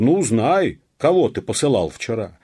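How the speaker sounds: high, loud crowd challenge. A narrator's reading. Peasants confront the police officer, loudly challenging.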